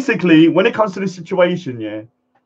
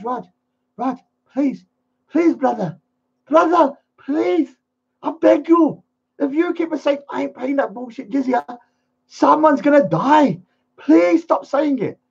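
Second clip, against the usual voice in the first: mock desperate pleading; 6 semitones higher than usual. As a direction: act out someone begging him to stop. Acts out someone begging him.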